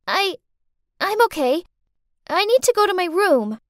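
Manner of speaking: put-on a little girl brushing off worry; nervous little girl.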